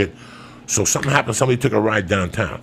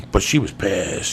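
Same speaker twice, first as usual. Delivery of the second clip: ordinary talk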